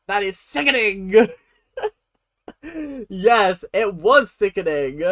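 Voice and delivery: loud, crying with laughter through the words; helpless real laughter; laughing hard. Real, not acted. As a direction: laugh till the tears come while repeating it. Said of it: "Oh, I'm actually gonna cry".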